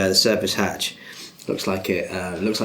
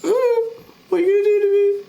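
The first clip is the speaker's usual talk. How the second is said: shrill, panicked captive; terrified high plea; 4 dB louder, much faster than usual. Acted. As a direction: beg in a high frightened voice. Captive NPC pleading.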